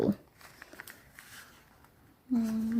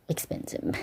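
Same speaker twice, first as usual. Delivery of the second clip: ordinary delivery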